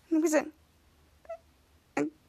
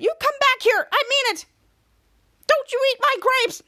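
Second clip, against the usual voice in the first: angry shouting; 9 semitones higher, 3 dB louder than usual. Acted. Catwoman yelling at Brad.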